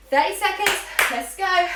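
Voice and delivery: loud rallying call; energetic call-out. Real, not acted. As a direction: pump the class up. Instructor calling out start.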